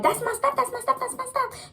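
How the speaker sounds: frantic high-pitched mock protest; acted-out, high-pitched freak-out; wilding out. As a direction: chant it high, like someone losing it. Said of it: "wilding out".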